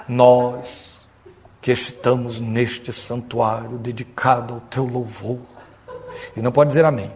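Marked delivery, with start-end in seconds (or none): 0.1-5.4 low, slow, soporific church drone; solemn liturgical prayer. Retold or imitated. Church brother praying while snoring is forbidden, droning solemnly. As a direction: drag out a formal prayer softly. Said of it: "ele ora assim".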